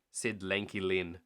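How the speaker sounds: usual speaking voice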